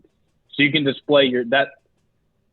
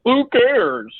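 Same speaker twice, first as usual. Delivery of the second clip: dismissive; 11 semitones higher, 3 dB louder than usual. Retold or imitated. Acted dismissive quote.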